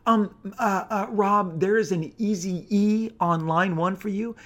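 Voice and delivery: a timid office receptionist announcing a caller. Retold or imitated. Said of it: "your receptionist says".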